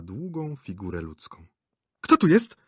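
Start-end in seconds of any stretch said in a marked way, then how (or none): 2.0-2.6 high, loud startled shriek; frightened scream. A narrator's reading. Startled actress to stranger under sofa, frightened scream. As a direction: scream it as you leap back. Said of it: "krzyknęła przerażona".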